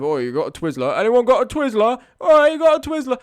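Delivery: put-on high, loud drunk voice asking around; drunk guy asking. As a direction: call out like a drunk stranger. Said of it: "he came up to everyone".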